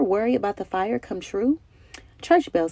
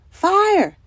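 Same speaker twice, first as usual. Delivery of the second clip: high, loud cry of alarm; calling out; 11 semitones higher, 6 dB louder than usual. A narrator's reading. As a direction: shout the warning down the street. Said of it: "they all called".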